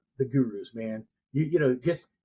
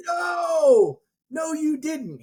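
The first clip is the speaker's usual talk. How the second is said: much higher, louder shouted no; mock guru shout; 12 semitones higher, 4 dB louder, much slower than usual. Guru correcting the seminar crowd, hammed-up shout. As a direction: bellow the correction like a stage preacher.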